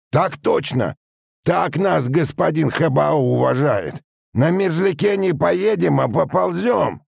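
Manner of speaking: high, angry machinist's yell; spiteful shouted complaint. A narrator's reading. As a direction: shout the grievance up to the captain. (злобно кричит, жалуясь)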